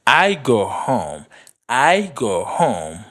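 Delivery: each word said slowly and distinctly; slow and clear. Shown as a demonstration. Teacher models a practice sentence, slowly and clearly. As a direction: draw out every word for learners. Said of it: "Fòk ou byen di mo yo".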